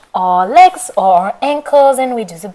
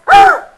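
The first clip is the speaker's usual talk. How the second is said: frightened high gasp; 8 dB louder than usual. Acted. Goldilocks sees the bears.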